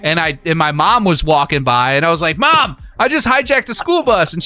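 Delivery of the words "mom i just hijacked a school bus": put-on high-pitched excited kid blurting it out. Kid excitedly telling his mom about the game, in a high voice. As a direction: boast to mom like an eager kid.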